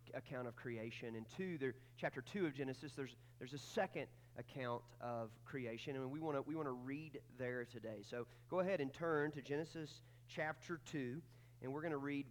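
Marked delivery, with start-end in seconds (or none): none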